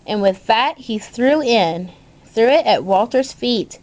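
plain reading voice

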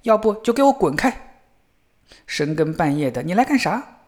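narrated shout, moderately forceful; yelling threat. A narrator's reading. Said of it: "小伙子扯着嗓子吼道".

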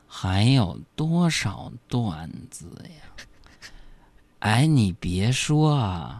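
sloth. Pretend. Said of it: "用树懒的方式来说话".

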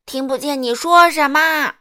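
very loud, high yelling; roaring shout. A narrator's reading. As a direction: bellow at the customer. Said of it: "水果店老板大吼大叫". (大吼大叫)

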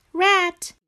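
high little frog calling; high, plaintive character voice. A narrator's reading. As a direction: call a friend's name, high-pitched. Frog calls Rat at night.

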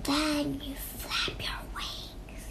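slow snake whisper; hushed, drawn-out hiss. A narrator's reading. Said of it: "he hissed".

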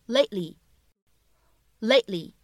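"lately" with the T held, never released; unreleased T. Shown as a demonstration. Teacher models the word slowly, "lately" with a swallowed T. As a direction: stop the T silently in "lately", then slide into "ly". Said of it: "它其实只是做了口型，并没有非常清晰的读出来".

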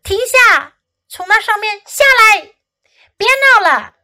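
high, loud string of commands; shouted orders. A narrator's reading. Fox orders wild kids down, shouting. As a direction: shout at rowdy children to stop. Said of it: "福克斯喊道".

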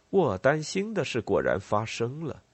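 usual speaking voice

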